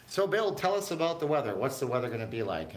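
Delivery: upbeat anchor handoff; chirpy TV news anchor; newscaster voice. Retold or imitated. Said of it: "and he goes".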